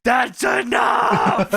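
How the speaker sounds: mock angry shout. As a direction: yell it like you're breaking up a fight. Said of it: "Nash is flipping out".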